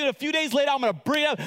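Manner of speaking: usual speaking voice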